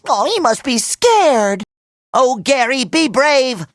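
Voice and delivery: normal talking voice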